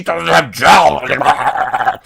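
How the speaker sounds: a hint of a big slug creature; Jabba voice. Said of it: "I can't even do the Jabba voice".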